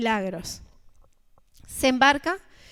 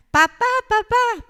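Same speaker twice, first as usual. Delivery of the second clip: put-on high, loud, slow wail of a child; a boy wailing for his dad; crying child's yell; 9 semitones higher, 7 dB louder than usual. Boy crying and yelling at father by the toy shop. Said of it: "empieza a llorar y a gritar".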